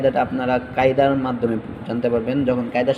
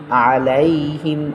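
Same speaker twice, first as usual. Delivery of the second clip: ordinary talk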